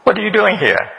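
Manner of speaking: high, pointed mock challenge; suspicious questioner. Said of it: "maybe we ask".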